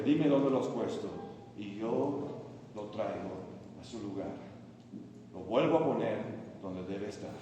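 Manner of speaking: soft, low, spaced-out phrases; hushed, deliberate promise; quiet resolve. Acted. Voices Mary's quiet resolve.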